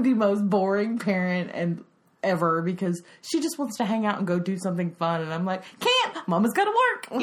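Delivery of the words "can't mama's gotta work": high, sing-song refusal; busy mom brush-off. Retold or imitated. Mom brushing off her eight-year-old, high and sing-song. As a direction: chirp it like a busy mom saying no.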